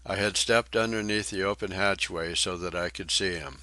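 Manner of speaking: plain reading voice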